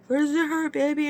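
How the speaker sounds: high-pitched mock babying; coddling partner. Partner coddles her during a massage, mocked in high coddling voice. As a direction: mimic his fussing in a high voice.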